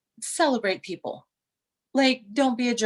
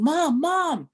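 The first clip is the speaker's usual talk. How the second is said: mock calling out; 7 semitones higher, 3 dB louder than usual. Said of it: "she comes running".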